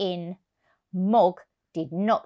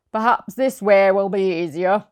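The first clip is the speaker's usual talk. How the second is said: vet's voice; 6 dB louder than usual. Acted. Vet voiced.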